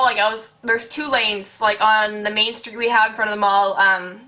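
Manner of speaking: ordinary talk, nothing marked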